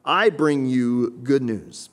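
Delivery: slow, loud, drawn-out proclamation; proclaiming scripture. Acted. Pastor voices the angel's announcement, slow and loud. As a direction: declaim it like the angel.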